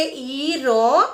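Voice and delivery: "ઈરોન" very slowly, sounds held long; "ઈરોન" stretched sound by sound. Shown as a demonstration. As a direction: draw out "ઈરોન" for a class to follow. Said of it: "આને શું વંચાશે?".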